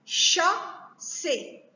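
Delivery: "Chasser" slowly as Cha... sser; two separate beats in "Chasser", a pause between; split into syllables. Shown as a demonstration. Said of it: "couper les mots en syllabes".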